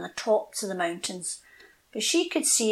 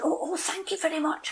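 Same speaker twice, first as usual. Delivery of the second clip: grateful robin; 8 semitones higher, 4 dB quieter than usual. Acted. Relieved robin thanks pine.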